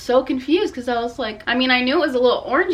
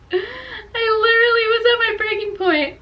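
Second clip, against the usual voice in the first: high, teary laugh-cry at her breaking point; laughing and crying at once, voice cracking high; 12 semitones higher than usual. Real, not acted. Telling friends about the ruined hair, half laughing, half crying. Laughing through tears at haircut.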